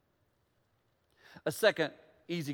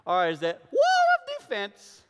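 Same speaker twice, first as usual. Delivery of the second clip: laughing; 8 semitones higher, 6 dB louder, slower than usual. Real, not acted. Laughing jab at defensiveness.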